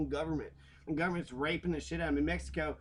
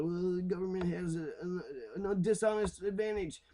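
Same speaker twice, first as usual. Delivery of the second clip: higher, put-on whiny voice of critics; mocking opponents; 4 semitones higher than usual. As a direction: sneer as you mimic the naysayers. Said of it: "people are".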